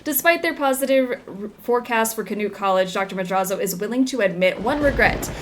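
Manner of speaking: newspaper article read aloud; news read. Acted. Reads in-game news article.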